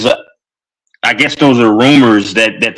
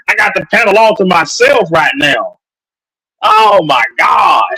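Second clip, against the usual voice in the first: excited yelling; 6 semitones higher than usual. Real, not acted. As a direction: shout it out on a sugar rush. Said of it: "That's why you heard me yelling".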